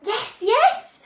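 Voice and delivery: high, loud eager cry; eager kids promising; keen young voices; children crying out. Acted. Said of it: "the children cried".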